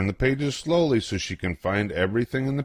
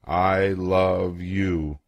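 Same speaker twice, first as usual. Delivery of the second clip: drawn-out silent mouthing; much slower than usual. Shown as a demonstration. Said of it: "without making a sound".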